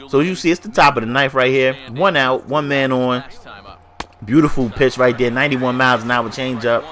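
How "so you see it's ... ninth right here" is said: ordinary talk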